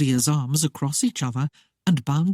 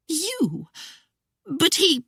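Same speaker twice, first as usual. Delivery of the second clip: much higher, bewildered; Claudia voiced; 13 semitones higher, slower than usual. A narrator's reading. Claudia staring at Christopher in disbelief, much higher voice. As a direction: stammer out in disbelief.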